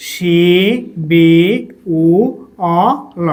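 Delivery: "শি বি উ অ ল" slowly, each sound apart; syllable by syllable. Shown as a demonstration. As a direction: pause after every sound of "শি বি উ অ ল". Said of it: "উচ্চারণটা কি হবে?".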